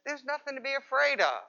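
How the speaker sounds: a hint of a parent calming a scared child; soothing parent. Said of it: "a well-meaning parent or maybe a babysitter came in and said".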